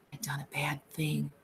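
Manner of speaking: a scared, breathy whisper; frightened whisper. A narrator's reading. Lennie whispers over the dead woman in fright. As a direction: whisper it in fright, barely audible. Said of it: "he whispered in fright".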